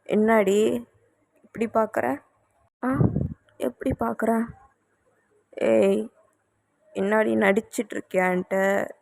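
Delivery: soft flirty half-whisper; hushed teasing. Acted. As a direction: tease her close to the ear. Husband teases her softly.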